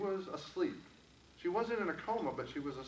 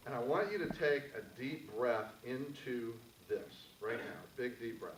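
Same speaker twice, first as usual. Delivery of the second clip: calm, gentle guided-exercise voice; guided meditation. Acted. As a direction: lead the room into stillness, unhurried. Leads audience in guided exercise.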